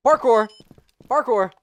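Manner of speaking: loud, high call-out; gleeful shout. Real, not acted. Player announcing jumps in the game, loud shout. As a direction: shout each one as a burst.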